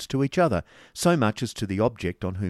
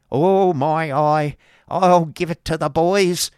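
put-on loud, high, drawn-out boyish glee; exclaiming child; 9 semitones higher, 9 dB louder, slower than usual. A narrator's reading. Child grasping father's cane, gleeful exclamation. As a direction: crow like an excited boy. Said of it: "exclaimed the interesting child".

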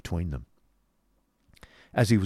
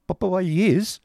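high, loud, anguished question; wretched boy's high outburst; distressed cry; 12 semitones higher, 6 dB louder, slower than usual. A narrator's reading. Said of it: "cried Smike".

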